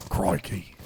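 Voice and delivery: put-on a mild Aussie accent on crikey; Steve Irwin crikey. Streamer to chat, trying an Australian crikey again. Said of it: "I was going for, like, a Steve Irwin".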